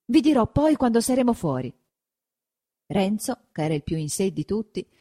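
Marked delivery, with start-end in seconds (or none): 0.0-1.7 put-on a terrified boy blurting out news; frightened, rushed report; breathless fright. A narrator's reading. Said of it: "riprese Menico ansante".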